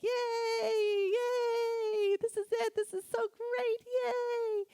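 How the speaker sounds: very high, loud gleeful yay; gleeful cheer while her husband takes the picture; excited squeal. Retold or imitated. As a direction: squeal like a kid posing for the camera. Showing off for the photo.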